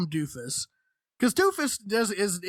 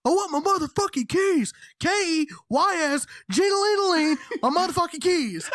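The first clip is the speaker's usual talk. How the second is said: high, loud hammed-up drunk yelling; furious drunk rant; 9 semitones higher, 4 dB louder than usual. Drunk Corey shouting at roommates for his keys, hammed-up fury. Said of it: "he is saying this with the most, like, vigor".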